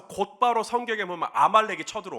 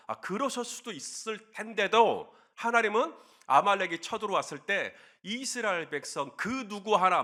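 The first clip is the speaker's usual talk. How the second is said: ordinary delivery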